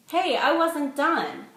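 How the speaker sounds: high, loud, slow whine; drunk protest. A narrator's reading. Tipsy woman protests her phone being taken, slow whine. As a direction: whine it, not finished yet.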